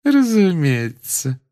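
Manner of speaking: slow, high, syrupy sweet. A narrator's reading. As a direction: coo it with sugary slowness. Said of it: "произнесла она сладким тоном".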